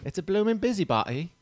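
high-pitched mock Cockney; Cockney accent. Co-host echoing Alfred P. Doolittle, Cockney accent. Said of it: "I get my English mixed up with the Cockney".

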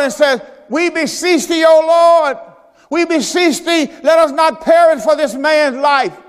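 high, loud crying out to God; desperate shouted prayer; shouted plea. Acted. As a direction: beg the Lord at full voice.

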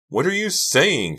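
high, loud outraged scream. A narrator's reading. Karina protests Delia's story, high scream. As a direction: scream it in disbelief. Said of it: "Karina screamed".

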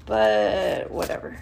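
a breathy throwaway whisper; whispered shrug. Real, not acted. Shrugging aside after overshare.

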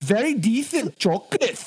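"very decent chocolate" lisped, high and laughing; badly faked high lisp on "very decent chocolate"; attempted lisp. Shown as a demonstration. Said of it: "The lisp thing. Dude, I can't".

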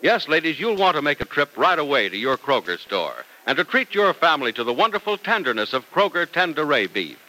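ordinary delivery

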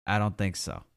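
normal talking voice

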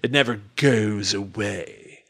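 put-on slow, low, unplaceable accent; affected, vaguely British accent; terrible affected accent. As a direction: imitate a joke transatlantic accent, low and drawn out. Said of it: "he adopts the accent".

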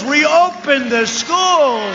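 slow, pounding rally chant; emphatic demand. Acted. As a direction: drag out Reopen the schools as a rally cry. Rally crowd, repeated demand.

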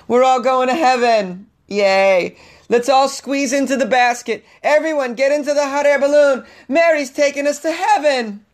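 very high, loud squealing rapture; ecstatic churchgoer. Pretend. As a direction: shriek like you've seen a miracle. Churchgoer voiced seeing Virgin Mary balloon.

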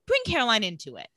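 ordinary talk, nothing marked

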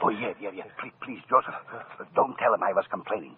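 soft, anxious begging kept low; hushed nervous pleading. Acted. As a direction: plead quietly so he won't hear. Musicians hush as maestro arrives.

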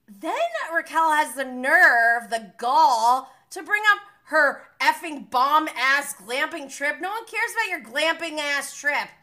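loud, exasperated outburst, punching the key words; indignant rant. Real, not acted. Host fuming over Raquel bringing up her trip, indignant rant. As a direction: go off loudly about her nerve. Ranting about Raquel's nerve.

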